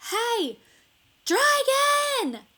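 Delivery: very high, very loud yell; shout as loud as possible. A narrator's reading. Elizabeth yells into the sleeping dragon's ear, full loud high shout. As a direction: yell right into his ear. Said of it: "She shouted as loud as she could".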